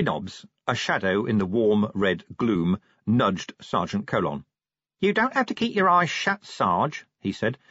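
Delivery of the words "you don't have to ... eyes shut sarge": matter-of-fact, pitched up; chatty Nobby. Acted. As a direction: reassure your sergeant in a perky common voice.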